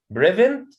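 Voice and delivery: "prevent" with the weight on PRE; the mistaken PRE-vent offered as an option; wrong first-syllable stress. Shown as a demonstration. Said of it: "not prevent".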